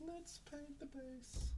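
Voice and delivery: tiny, high, soft voice; small high-pitched echo, drawn out; playful high echo. Pretend. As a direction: repeat it slowly in a quiet falsetto. Echoes own line in put-on voice.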